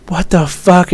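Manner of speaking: high incredulous "what the fuck"; startled, rising exclamation; retold disbelief. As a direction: pitch it up in shock. Retelling his reaction again.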